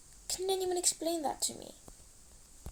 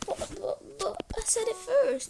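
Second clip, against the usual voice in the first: put-on whiny kid; 7 semitones higher, 4 dB louder than usual. Kid whining at parents.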